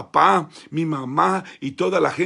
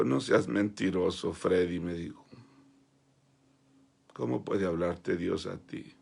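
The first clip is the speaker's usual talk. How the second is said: lower, softer scornful putdown; contempt; 8 semitones lower, 7 dB quieter than usual. Retold or imitated. Pastor scorning the poor boy, low and contemptuous. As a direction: dismiss him with disdain. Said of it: "me vio con desprecio".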